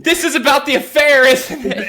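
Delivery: loud, high guilty outburst; high-pitched hammed-up alarm; mock alarm. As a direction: cry out like someone found out. Acts guilty man's exclamation.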